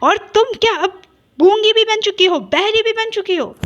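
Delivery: high, loud furious insults; furious insults at the sister in the wheelchair; angry taunt. A narrator's reading. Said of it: "एकदम गुस्से में उसको कहते हैं".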